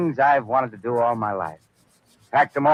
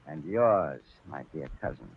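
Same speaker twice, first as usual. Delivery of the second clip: sinister low hush; 9 semitones lower, 9 dB quieter than usual. Acted. Poisoner to his cousin, a soft sinister hush. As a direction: drop to a menacing near-whisper, softer and lower.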